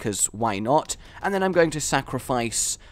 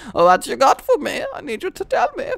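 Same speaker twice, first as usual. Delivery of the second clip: lightly put-on German accent, higher and louder; mock German accent; 13 semitones higher, 6 dB louder than usual. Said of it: "I'm getting German here".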